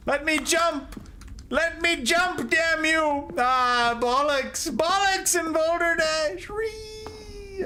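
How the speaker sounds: high-pitched protest at the game; exasperated outburst. Real, not acted. Player fighting the jump control, exasperated outburst. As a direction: cry out high in frustration, into a thin squeal. Frustrated outcry while playing.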